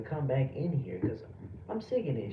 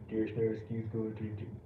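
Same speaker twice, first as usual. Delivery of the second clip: mocking the viewer; 8 semitones lower, 6 dB quieter than usual. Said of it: "talking about".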